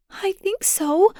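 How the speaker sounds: put-on high, slow and tearful little girl; crying child. Daughter answers her father through tears, slowly. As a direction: hold back tears on each word. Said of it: "she cried".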